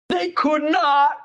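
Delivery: high, slow astonishment; amazed. Real, not acted. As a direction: stretch it out in wonder. Amazed at the frozen hands.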